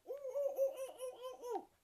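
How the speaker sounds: very loud, high monkey hoots; bellowing monkeys. Acted. Monkeys bellowing back at the man, loud high hoots. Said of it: "bellowed back at him".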